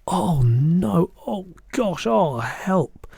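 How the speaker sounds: high, disgusted little moans; revolted dismay. A narrator's reading. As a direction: moan in dismay at a horrid taste. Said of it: "She spat it out quickly".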